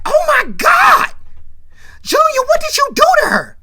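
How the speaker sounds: loud, very high horrified shouting; horrified scream. Retold or imitated. Ronnie confronts Junior over Ebony, horrified screaming. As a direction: cry out in shock, voice shooting way up. Sister discovers what brother did.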